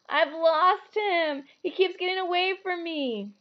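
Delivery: high, slow wail of loss. Acted. Boy who lost his toad, high slow wail. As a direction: wail it out, near tears. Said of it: "he wailed".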